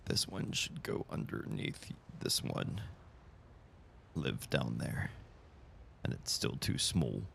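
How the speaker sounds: breathy muttered aside; barely audible working mutter; whispering to self. Real, not acted. Muttering while adjusting stream layout.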